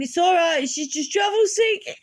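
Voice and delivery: high, laugh-cracked mum reassurance; laughing mum quote. Retold or imitated. Mum explaining to the field stewards, high and laughing. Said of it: "my mum's leaning out going".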